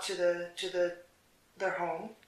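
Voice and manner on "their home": ordinary delivery, nothing marked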